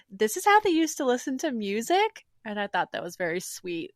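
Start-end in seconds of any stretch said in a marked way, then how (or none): 0.1-2.3 a hint of a wide-eyed boy, lightly raised; amazed boy voice. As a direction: marvel at it like a curious kid. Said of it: "And then Roy's like".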